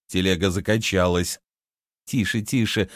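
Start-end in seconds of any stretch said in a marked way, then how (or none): none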